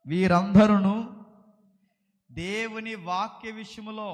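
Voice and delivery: slow, measured reading in separate phrases; scripture reading. Acted. Preacher reads a Bible verse to the congregation, slow scripture reading. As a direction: read it out slowly, pausing after each phrase.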